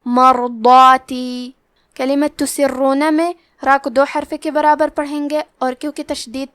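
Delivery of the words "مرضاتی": مرضاتی slowly, ر and ض heavy, alif stretched heavy; heavy raa and daad. Shown as a demonstration. Tajweed teacher showing heavy letters, slow recitation. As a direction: recite مرضاتی with full heavy ض and long madd. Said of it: "مد الف کو بھی بھاری آواز میں پڑھنا ہے".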